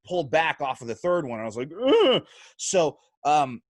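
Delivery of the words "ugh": mock disgusted grunt. Recoiling after punching the guy.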